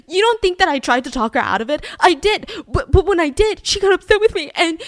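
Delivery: hammed-up frantic excuse; mock pleading. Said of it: "And he's like".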